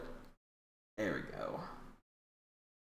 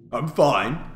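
ordinary talk

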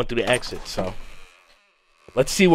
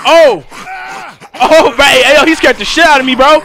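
loud, high jump-scare yell; startled shout; 13 semitones higher, 9 dB louder than usual. Real, not acted. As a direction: yelp as the zombie appears. Jump scare while playing.

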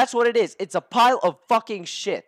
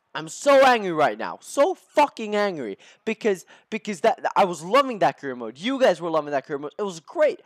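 ordinary talk, nothing marked

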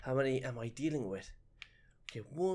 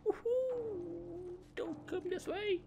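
panicked squeal; 4 dB quieter, slower than usual. Real, not acted. Panicked squeals as enemy nears.

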